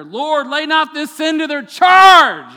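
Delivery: slow, full-throated scream at the top of the lungs; belted prayer. Acted. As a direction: belt it out with everything left in your lungs. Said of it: "belts out this incredible prayer".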